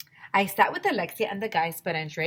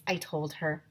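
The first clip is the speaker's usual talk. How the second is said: plain reading voice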